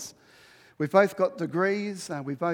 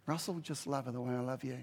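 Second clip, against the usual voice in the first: softer, lower patient reply; gentle voice of God; 5 semitones lower, 8 dB quieter than usual. Retold or imitated. God answering Russell, soft and low. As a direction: answer calmly and softly. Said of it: "He said".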